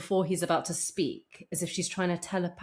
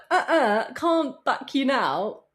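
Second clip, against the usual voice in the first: high-pitched, louder mock refusal; Seth Rogen; 8 semitones higher, 5 dB louder than usual. Celebrity withdrawing support from Danny, high mock refusal. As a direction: imitate a celebrity backing away, amused and exaggerated. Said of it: "He's like".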